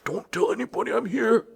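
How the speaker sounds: high, stifled hush; secretive Santa. Retold or imitated. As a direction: shush the child as a caught Santa. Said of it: "I'd be wearing my Santa".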